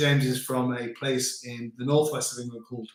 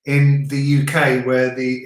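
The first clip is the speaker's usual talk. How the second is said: usual speaking voice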